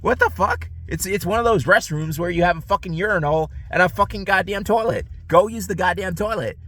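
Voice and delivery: real exasperation, pitch soaring; exasperated rant. Real, not acted. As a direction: vent in high-pitched disbelief. Exasperated rant about the stranger.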